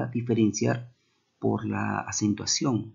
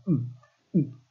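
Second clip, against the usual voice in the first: closed lips humming mm, higher; a teacher's mm demo with lips pressed together; 5 semitones higher than usual. Shown as a demonstration. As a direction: hum through shut lips to show the sound. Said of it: "pero dilo en fonema".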